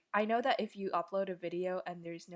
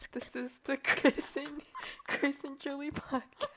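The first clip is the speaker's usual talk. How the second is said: a touch higher, slow "Chris and Julie podcast" announcement; intro announcer; 5 semitones higher than usual. Acted. Opening the podcast, mock announcer voice. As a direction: announce the show, lightly hammed. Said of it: "roll the intro".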